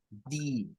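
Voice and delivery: "di" short and quiet; short i ending. Shown as a demonstration. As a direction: clip it to "di". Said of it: "con una I corta".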